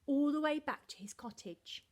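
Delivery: usual speaking voice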